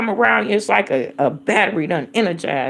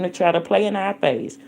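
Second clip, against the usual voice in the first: usual speaking voice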